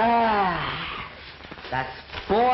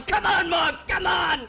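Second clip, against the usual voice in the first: pretend crowd yelling; 12 semitones higher, much slower than usual. Kid imagines friends cheering him home, shouting. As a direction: yell it like a fan at the home stretch. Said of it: "You're gonna be yelling".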